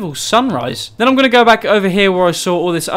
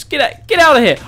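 shouting; 8 semitones higher, 3 dB louder than usual. Real, not acted. Shooing attacking mobs.

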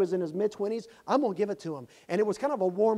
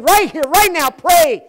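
high loud call to pray; urgent command; 10 semitones higher, 17 dB louder, slower than usual. Retold or imitated. Preacher urging the young man to pray, loud and high. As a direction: urge him loudly to pray now.

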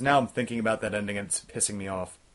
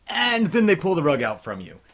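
emphatic outburst; 5 semitones higher, 8 dB louder than usual. Real, not acted. Reviewer reacts to a twist ending.

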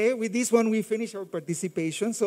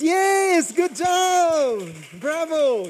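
excited praise; 5 semitones higher, 5 dB louder, much slower than usual. Real, not acted. Praising a child's right answer.

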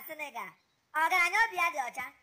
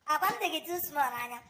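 ordinary delivery

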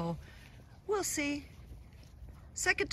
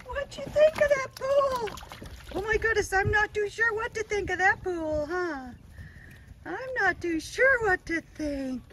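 put-on baby talk to puppies; 9 semitones higher than usual.

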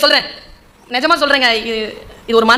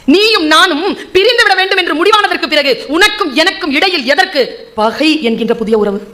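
poetry declamation; 4 semitones higher than usual. Shown as a demonstration. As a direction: declaim the couplet to a hall, then let it sink. Recites the verse again, declaimed.

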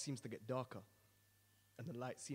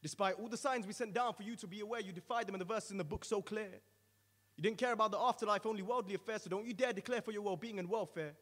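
higher, louder, scolding thunder of judgement; stern angel's rebuke; 5 semitones higher, 7 dB louder than usual. Acted. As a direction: rebuke him from above, full force.

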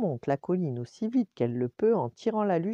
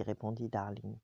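ordinary delivery, nothing marked

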